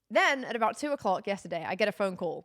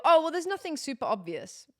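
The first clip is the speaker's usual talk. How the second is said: higher-pitched mock doctor brushing it off; dismissive doctor; 7 semitones higher, 3 dB louder than usual. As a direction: imitate a doctor shrugging off the problem. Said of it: "and they go".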